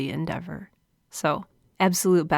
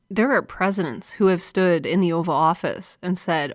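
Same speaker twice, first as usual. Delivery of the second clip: normal talking voice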